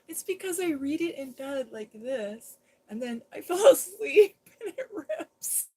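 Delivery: giggling through the words, voice climbing high; laughing. Real, not acted. To viewers about her torn Bible, laughing. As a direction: laugh at yourself while you explain.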